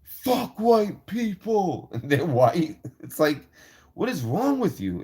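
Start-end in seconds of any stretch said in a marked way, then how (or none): 0.0-1.9 high, very slow sneering slogan; mocking self-hating whites. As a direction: drag it out like a smug protester.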